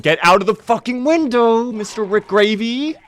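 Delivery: high, loud frantic yell. Real, not acted. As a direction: scream at the window. Yells at zombie in window.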